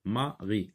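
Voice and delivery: "mari" broken into ma, ri; split into syllables. Shown as a demonstration. Teacher repeats the syllable count, "mari" split ma - ri. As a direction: say "mari" as two separate quiet syllables. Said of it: "deux syllabes".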